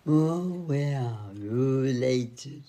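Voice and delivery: cow voice. Pretend. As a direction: moo it like a cow. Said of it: "say it like a cow".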